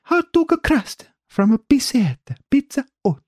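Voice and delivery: high, exaggerated Italian accent; high-pitched mock-Italian food names; hammed-up Italian accent. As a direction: put on a cartoon Italian accent. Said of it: "Do you like my Italian accent?".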